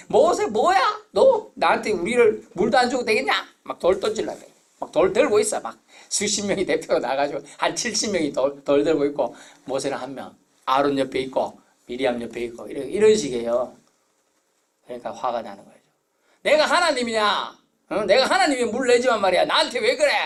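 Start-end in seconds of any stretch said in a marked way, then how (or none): 0.1-3.3 high, loud threatening yell; angry mob. Retold or imitated. 16.4-20.1 high, loud exasperated shout; angry retort. Retold or imitated. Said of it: "막 이렇게 화를 낸 거예요".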